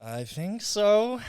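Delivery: high, slow nervous answer; mock nervous. Facing the whipped cream challenge, mock nervous and high. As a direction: feign being scared of the whipped cream. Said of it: "why are you scared?".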